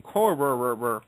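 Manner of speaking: Corrererererrer with a long rolling trill; mock rolled name. Shown as a demonstration. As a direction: roll the r on Corrererererrer over and over. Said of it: "kind of addicting".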